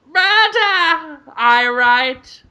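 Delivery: high-pitched mock TV sleuth catchphrase; Murder, She Wrote detective. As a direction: pitch it up like a hammed TV mystery impression. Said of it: "Murder, She Wrote".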